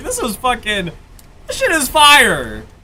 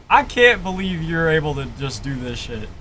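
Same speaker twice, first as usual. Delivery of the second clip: usual speaking voice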